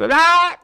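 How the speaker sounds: sudden loud, high wordless yell; mock Hitler shout. Podcast guest mimicking Hitler's shouts to show intonation, high explosive yell. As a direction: burst into an imitated orator's shout. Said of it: "aqueles gritos".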